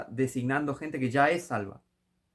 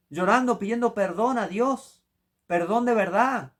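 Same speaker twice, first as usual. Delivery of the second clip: higher, louder, slowed pleading sermon; impassioned preaching; 7 semitones higher, 4 dB louder than usual. Acted. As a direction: preach repentance with tears in the voice. Preaching at full force.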